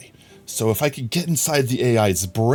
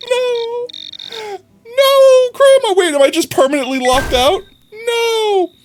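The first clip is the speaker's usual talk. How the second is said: desperate pleading; 3 dB louder than usual. Pretend. Pleading to be let in.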